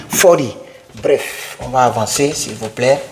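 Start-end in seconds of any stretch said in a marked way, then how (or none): 0.1-0.5 "forty" said as "fordy", American style; "forty" with a light flapped T; forty with soft D. Shown as a demonstration. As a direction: turn the T of "forty" into a small D. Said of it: "On comme un petit D".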